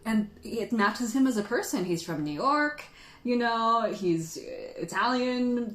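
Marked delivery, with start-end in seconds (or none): none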